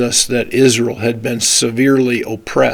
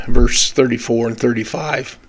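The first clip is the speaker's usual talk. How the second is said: ordinary delivery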